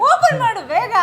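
high, loud hurry-up call; impatient urging. Real, not acted. Wife hurrying husband to open the present, loudly impatient. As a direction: push them to hurry, loudly.

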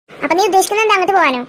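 usual speaking voice

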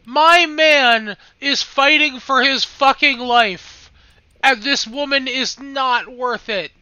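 slow, loud outburst; loud outraged. Real, not acted. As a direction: shout it out in outrage. Shouting at screen for character.

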